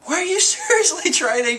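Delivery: very high, strained indignation; hammed-up indignant outburst; mock outrage. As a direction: demand it in a high, scandalized voice. Voicing outraged Sanji's demand.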